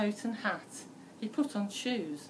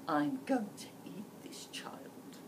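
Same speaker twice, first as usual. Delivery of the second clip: whispered thought; 3 dB quieter than usual. A narrator's reading. Wolf secretly plotting to eat Red, whispered. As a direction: sly whisper to himself. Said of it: "He thought".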